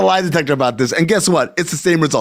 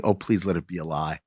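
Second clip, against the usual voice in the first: praying fans; 13 semitones lower, 12 dB quieter than usual. Retold or imitated. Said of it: "they're praying".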